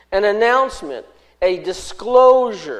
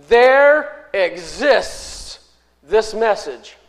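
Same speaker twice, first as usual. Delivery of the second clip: "There exists" drawn out very slowly and louder; emphasis on exists; 4 dB louder, much slower than usual. Shown as a demonstration. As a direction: lean hard on "There exists". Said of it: "there's an emphasis on the word".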